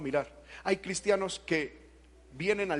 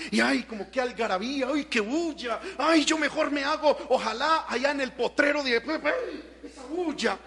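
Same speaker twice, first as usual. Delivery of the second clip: higher-pitched mock grumbling; put-on whiny fed-up voice; bored complainer; 6 semitones higher than usual. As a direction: imitate a churchgoer moaning about the racket. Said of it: "nos aburre en la alabanza".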